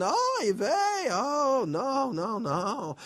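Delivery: put-on high wailing oy vey in a Yiddish accent; mock crying lament in a Yiddish accent.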